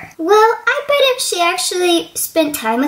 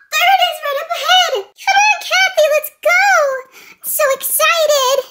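excited; 9 semitones higher than usual. Acted. Eager girl spotting the stables.

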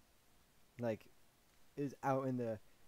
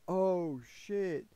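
higher, louder mock-panicked exclamation; retold alarm; 6 semitones higher, 4 dB louder than usual. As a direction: blurt it high, reenacting the alarm. Retold alarm at cop cars arriving.

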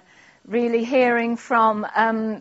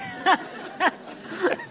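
hysterical laugh; 8 semitones higher, 5 dB quieter than usual. Real, not acted. Reader breaks up at the letter.

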